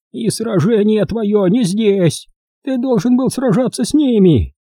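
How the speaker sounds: put-on high, slow, insistent old woman's lecturing; preachy old crow. A narrator's reading. Old crow lecturing the boy about the fight, high and preachy. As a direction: drive the lesson home like a stern old crow. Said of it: "назидательно проговорила мисс Кроу".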